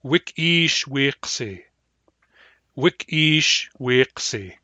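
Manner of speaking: teacher modelling the phrase, slow and distinct; slow model pronunciation. Shown as a demonstration. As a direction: sound out every syllable slowly and clearly. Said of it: "then we'll say".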